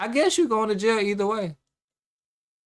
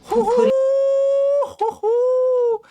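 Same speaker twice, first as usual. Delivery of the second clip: loud, high "hoo-hoo" hoot; long-held falsetto hoot; 8 dB louder, much slower than usual. Real, not acted. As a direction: let out two big, high hoots. Reacting to played confession with a hoot.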